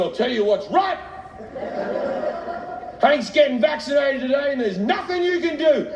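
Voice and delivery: acted angry announcement, pitched high; worked-up, high-voiced quarrel; steaming. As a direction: defiantly lay down the law to a partner. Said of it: "came back just fucking steaming".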